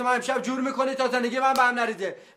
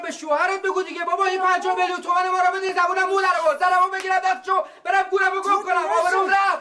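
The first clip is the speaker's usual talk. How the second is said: shouting up at his father, pitch raised; angry shout; 4 semitones higher than usual. Acted. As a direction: hurl the accusation at him. Son shouting complaint to mother.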